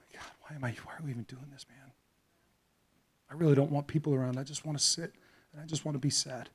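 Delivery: hushed, broken inner lament; despairing whisper. Acted. Grieving father praying under his breath, hushed and despairing. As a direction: whisper it like a father too heavy to go on.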